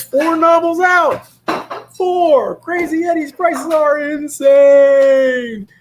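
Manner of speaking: very high, loud, drawn-out pitchman shout; over-the-top commercial announcer; hype ad announcer. Pretend. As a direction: sell it like a crazy TV ad. Hype ad-style promo for books.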